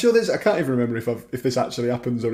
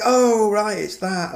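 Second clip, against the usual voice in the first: higher, excited "oh, right" as a fan; excited recognition; 9 semitones higher, slower than usual. Retold or imitated. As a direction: imitate a fan spotting a reference, excited. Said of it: "you'll be like".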